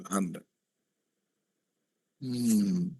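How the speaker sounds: usual speaking voice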